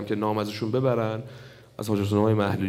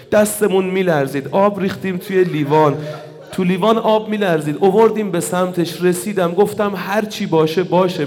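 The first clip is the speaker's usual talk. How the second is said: loud, high, tearful preacher's cry; impassioned preaching; 5 semitones higher, 7 dB louder than usual. Acted. Preacher telling mourners of the father's last moments, wailing. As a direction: build the grief to a full-force wail. Climax of martyr's story, preached.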